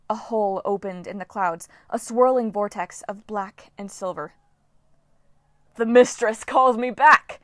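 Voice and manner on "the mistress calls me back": loud, slow triumphant shout; gleeful shout. Acted. Storm spirit Dylan shouting with glee as the vortex opens. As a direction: crow it out with glee. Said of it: "Dylan shouted with glee".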